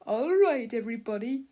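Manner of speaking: loud barked opener; gruff shout. A narrator's reading. Mister Krupp addressing the class, loud. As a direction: call the class to order. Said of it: "he shouted".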